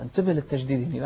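ordinary talk

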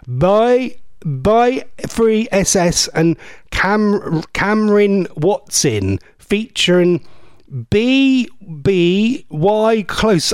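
"by free S S and Cameron Watson featuring B B Y Close" read out very slowly, letter by letter; puzzled, slow reading of "B B Y Close" as separate letters; sounding out. Shown as a demonstration. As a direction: spell out the strange title slowly, unsure of every part. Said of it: "this makes no sense, does it?".

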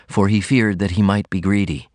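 usual speaking voice

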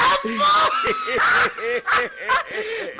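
shrieking laugh, high and loud. Acted. Guest laughs hard at the head-split joke. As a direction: let the laugh run high and helpless.

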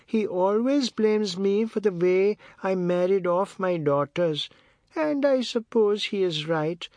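put-on slow elderly-matriarch voice, pitched well up; Missus Tulsi, proud. A narrator's reading. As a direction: draw it out high, proudly self-deprecating. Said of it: "She spoke with pride in Oad".

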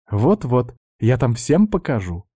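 ordinary delivery, nothing marked

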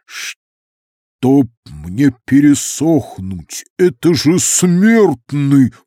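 bubbling, slow watery voice; gurgling alien voice. A narrator's reading. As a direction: gurgle the words like a fountain. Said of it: "пробулькал голос".

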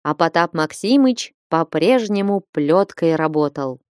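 ordinary delivery

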